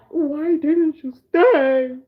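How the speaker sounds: very slow, whiny and tearful; hammed-up sad whine; mock whimpering. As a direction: plead like an abandoned kid. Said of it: "looking at his phone like".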